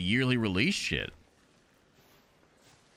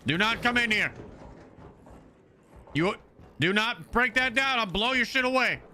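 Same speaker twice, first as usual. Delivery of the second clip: shouted warning; 8 semitones higher than usual. Real, not acted. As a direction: bark the warning to keep them out. Warning enemy player in game.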